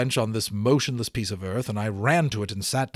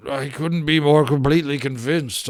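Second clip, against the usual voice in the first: a lightly groaned line, a bit louder; groaning; 4 dB louder than usual. Acted. Seasick professor groans his reply to Mister F. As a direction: groan it out like you feel sick. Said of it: "I groaned".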